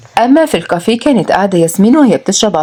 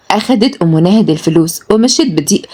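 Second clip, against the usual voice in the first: plain reading voice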